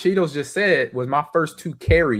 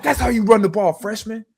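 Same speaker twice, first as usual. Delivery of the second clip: high-pitched mock yell, dropping off at the end; retold crazy shouting; 10 semitones higher than usual. Lineman to freshman, retold crazy yell. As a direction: imitate a fired-up lineman. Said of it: "just some of his crazy talk".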